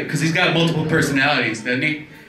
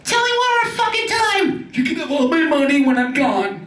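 much higher squealed voice; rich girl. Retold or imitated. Rich girl egging on her old man, squealing high. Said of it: "She'd be like".

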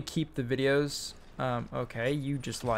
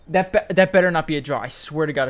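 angry; 3 semitones higher, 11 dB louder than usual. Real, not acted. Fearing a draw after error.

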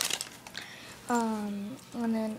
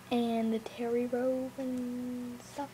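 whispering; much slower than usual. Real, not acted. Whispering to camera.

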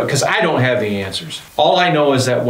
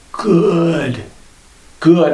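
frail grandmother voice; 5 semitones higher than usual. Retold or imitated. Dying grandmother voiced in story.

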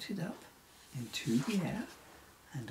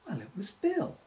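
ordinary delivery, nothing marked

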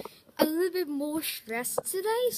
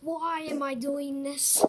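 usual speaking voice

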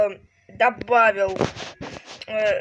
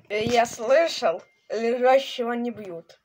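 ordinary talk

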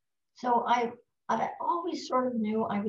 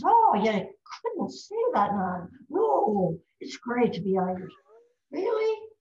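Irish pub drinker; 2 semitones higher, 4 dB louder than usual. Acted. Second pub drinker argues with his mate, lively Irish brogue. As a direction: protest with big Irish swoops. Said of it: "This is an Irish pub we're in".

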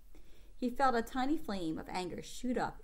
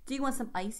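plain reading voice

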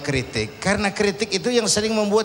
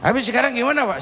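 curious son; 3 semitones higher, much faster than usual. Acted. Son asking his father what to do next, high and eager. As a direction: ask like an eager young son.

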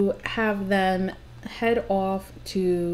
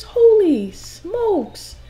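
drawn-out amazement; 9 semitones higher, 4 dB louder, much slower than usual. Real, not acted. Reacting to the pile of simoleons.

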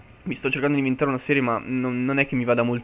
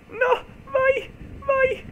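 high panicked cry; much slower than usual. Real, not acted. Mob right behind him.

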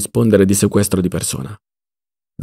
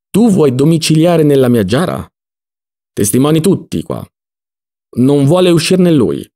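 higher, mildly indignant protest; indignant appeal to witnesses; acted outrage; 4 semitones higher, 3 dB louder than usual. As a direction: protest as the wronged owner, pitched up. Acted quarrel, owner outraged at squatter.

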